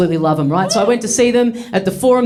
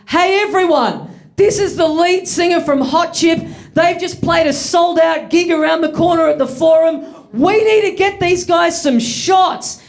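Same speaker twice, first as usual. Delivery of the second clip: high, slow, hyped announcement to a room; rally the whole bar; excited announcing; 8 semitones higher than usual. Retold or imitated. Fan announcing singer to whole bar.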